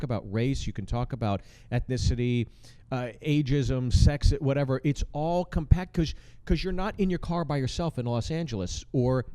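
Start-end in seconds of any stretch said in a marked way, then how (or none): none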